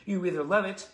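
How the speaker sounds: lively, emphatic tribute read; fan letter reading. Real, not acted. Host reads Natalie's praise of the front man, emphatic letter reading. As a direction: build the praise with rising enthusiasm.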